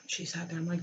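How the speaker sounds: ordinary talk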